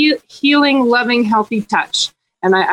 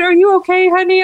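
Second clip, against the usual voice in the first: concerned stranger; 8 semitones higher, 3 dB louder than usual. Retold or imitated. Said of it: "people would stop me on the street".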